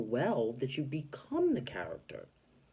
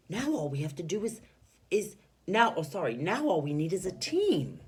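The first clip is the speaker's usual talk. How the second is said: eager, a bit louder; excited reading; 6 semitones higher, 4 dB louder than usual. Shown as a demonstration. Teacher voicing Mister Potter's excitement for the class. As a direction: sound keen to start a team. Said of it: "he was excited".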